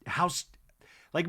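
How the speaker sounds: ordinary talk, nothing marked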